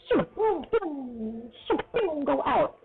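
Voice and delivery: very high sing-song sound effects; high playful whoosh and boom; whooshing imitation. Shown as a demonstration. Imitates words flying through the ears.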